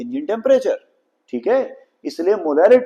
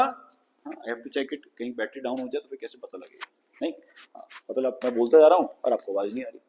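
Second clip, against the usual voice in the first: quiet aside; 5 semitones lower, 8 dB quieter, faster than usual. Real, not acted. Checking mic battery aside.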